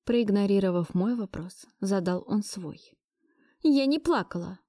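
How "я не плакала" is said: snappy, higher-pitched denial; snapping back. A narrator's reading. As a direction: snap back defensively. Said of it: "огрызнулась я". (огрызаясь, повыше)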